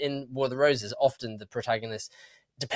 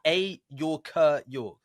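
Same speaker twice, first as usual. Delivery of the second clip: put-on Yorkshire accent; 5 semitones higher, much slower than usual. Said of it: "Why are you doing that accent?".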